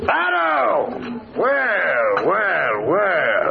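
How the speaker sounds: high-pitched greeting call, falling on each word; hailing shout. Acted. Grigsby spotting old pal Farrell at the inn, calling out. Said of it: "and he shouts".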